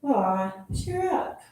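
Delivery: usual speaking voice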